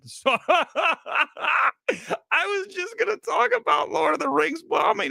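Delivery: high, giggling through the words; laughing. Real, not acted. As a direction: laugh at yourself while getting back on track. Laughing at own rant.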